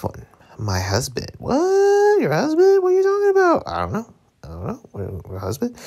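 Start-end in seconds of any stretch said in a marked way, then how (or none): 1.4-3.6 very high squeaky questioning; mock shocked falsetto. Acted mock exchange about husband. 3.7-5.8 low, soft confused reply; mock clueless mumble. Low replies in acted exchange.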